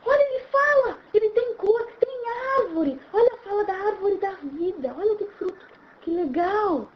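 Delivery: high delighted gushing; giddy wonder. Acted. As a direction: exclaim like a child seeing a pop-up book. Acts wonder at pop-up book.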